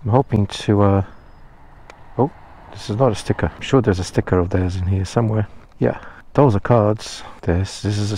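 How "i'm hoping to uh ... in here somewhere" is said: usual speaking voice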